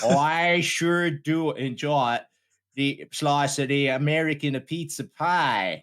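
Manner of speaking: slow, hammed-up American drawl; improvised American accent. [name] answers Larry about pizza, slow mock American accent. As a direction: stretch every vowel in a mock American accent. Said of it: "fifty percent engaged in the accent".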